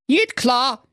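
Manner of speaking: high, cheeky Berlin voice; cocky Berlin hero. Acted. Schnitzelschorsch accepts the king's challenge, high cheeky Berlin voice. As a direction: toss off the challenge with swagger.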